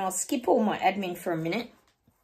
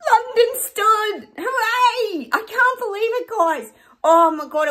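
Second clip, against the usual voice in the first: high, loud, elated cheer; real excitement; 12 semitones higher, 6 dB louder, slower than usual. Real, not acted. Vlogger celebrating London with viewers, ecstatic. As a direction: whoop it out, drawing out the first words. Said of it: "I'm just too excited".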